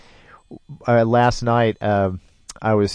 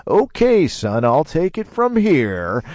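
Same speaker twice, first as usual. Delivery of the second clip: calm captain; 8 semitones higher, 3 dB louder, slower than usual. Retold or imitated. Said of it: "the captain very calmly said".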